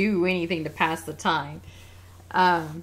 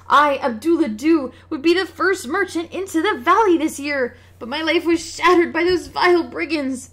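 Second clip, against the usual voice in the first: a robbed merchant, woeful and louder; lamenting merchant; 6 semitones higher, 6 dB louder than usual. Acted. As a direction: bewail his losses like a ruined trader.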